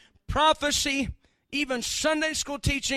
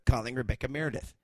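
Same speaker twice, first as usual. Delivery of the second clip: fast, flat robot voice; 12 semitones lower, faster than usual. Acted. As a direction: answer like a phone assistant. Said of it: "and then it goes".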